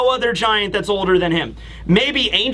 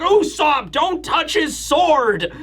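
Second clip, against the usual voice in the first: higher, drawn-out mock-alarmed scolding; retold urgent warning; 6 semitones higher, slower than usual. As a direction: warn off a meddling friend, pitched up. Retelling giant scolding Usopp.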